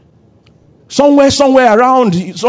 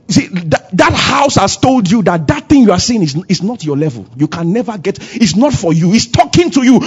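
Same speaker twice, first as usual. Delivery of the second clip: ordinary talk, nothing marked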